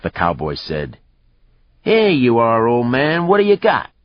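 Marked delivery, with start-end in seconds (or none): 1.8-3.9 high, loud jeering challenge; loud taunt. A narrator's reading. Cowboy taunting an old man before a fight, loud jeer. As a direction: goad him, voice leaping up.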